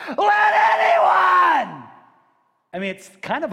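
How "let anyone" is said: drawn-out shout, much higher and louder, slow; loud scream. Retold or imitated. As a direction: scream it to seize attention. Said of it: "in a loud voice".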